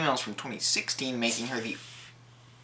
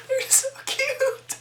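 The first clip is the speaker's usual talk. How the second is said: much higher, slow cooing; much slower than usual. Real, not acted. Gushing to viewers about the cute snakes, much higher and slow. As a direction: melt over how cute they are.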